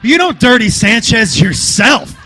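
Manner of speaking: usual speaking voice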